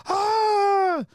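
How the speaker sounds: very high, loud drawn-out "ah"; mock-horrified high cry; imitated shocked gasp. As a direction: mimic a scandalised mother's shriek. Imitates the mom's shocked reaction.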